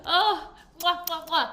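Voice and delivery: blowing kisses, high and laughing; between kisses. Real, not acted. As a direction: smack kisses to the audience.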